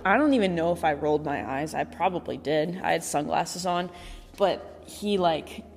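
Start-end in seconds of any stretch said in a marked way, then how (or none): none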